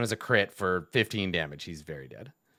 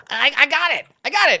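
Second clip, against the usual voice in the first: high, loud squeaky goblin; 8 dB louder than usual. Acted. Goblin calling back to the bugbear, high and loud. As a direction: yelp it up high like an eager little goblin. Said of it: "this goblin looks back at the bugbear and is like".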